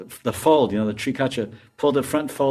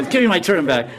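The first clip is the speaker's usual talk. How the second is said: demanding shout; 6 semitones higher, 5 dB louder than usual. Acted. Madhu demanding turban.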